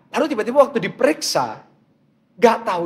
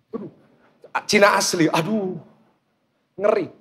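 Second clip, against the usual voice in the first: ordinary talk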